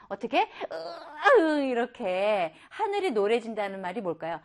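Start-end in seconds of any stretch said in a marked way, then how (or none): 1.4-1.6 acted groan of effort; mock straining, as if pushing hard. Shown as a demonstration. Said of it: "힘을 줬습니다".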